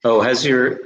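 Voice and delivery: ordinary talk, nothing marked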